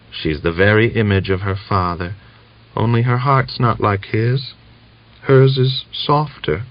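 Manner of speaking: fading mother smiles faintly; feeble smile. A narrator's reading. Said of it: "said with a feeble smile".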